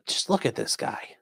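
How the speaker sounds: hushed, appalled aside; unvoiced disgusted whisper. Real, not acted. Streamer showing viewers the photo, in a disgusted whisper. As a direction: breathe it out in a whisper.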